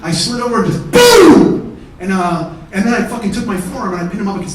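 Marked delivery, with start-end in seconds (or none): 0.9-1.6 loud drawn-out boom; explosive imitated impact; punch sound effect.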